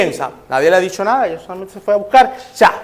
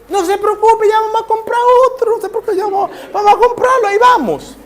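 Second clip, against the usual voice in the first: much higher, bubbly and laughing; eager reassurance; 12 semitones higher than usual. Acted. Teresita cheerfully promising Sofía a new cake, high and laughing. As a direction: rush in cheerfully to fix everything.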